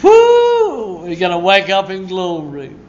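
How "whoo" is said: high, loud whoop; joyful whoop. Real, not acted. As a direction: whoop with joy. Joyful whoop after laugh.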